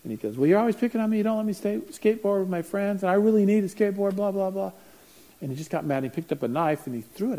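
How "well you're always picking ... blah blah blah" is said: put-on whiny kid. Son whining at mom.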